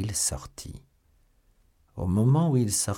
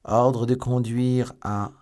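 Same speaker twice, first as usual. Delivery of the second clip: slow, high, loud official reading; reading aloud; 5 semitones higher, 6 dB louder, slower than usual. A narrator's reading. Lord de Winter reading the order, slow and loud. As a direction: read the order out formally. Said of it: "il lut".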